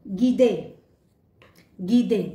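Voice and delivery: French "guide", गीदे. Shown as a demonstration. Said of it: "एक और example देखते हैं।".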